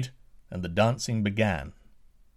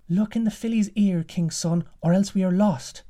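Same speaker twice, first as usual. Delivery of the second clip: much higher, fast urgent voice; urgent daughter; 8 semitones higher, 4 dB louder than usual. Acted. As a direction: hurry him, high and breathless with urgency. Daughter urges the prince.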